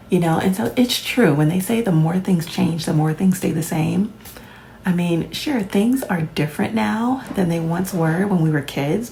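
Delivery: ordinary talk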